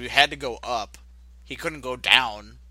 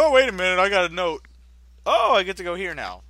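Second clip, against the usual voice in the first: sarcastic, higher-pitched character voice; mock sudden realization; 6 semitones higher, 5 dB louder than usual. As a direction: play the hero suddenly remembering.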